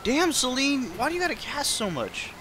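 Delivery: high-pitched exclamation at the game; exasperated outburst. Real, not acted.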